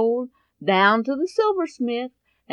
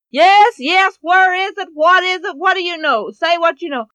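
higher, louder urgent questioning; eager mother; 6 semitones higher, 6 dB louder than usual. Acted. Mother pressing her son about the missing money, urgent and loud. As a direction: press him for the answer, desperate.

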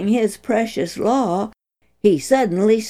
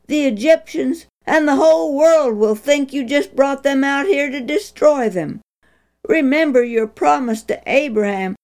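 higher, urgent pleading; pleading cry; 6 semitones higher than usual. A narrator's reading. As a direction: beg God desperately. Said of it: "He cried".

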